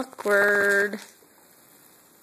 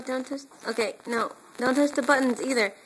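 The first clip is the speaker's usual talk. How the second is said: normal talking voice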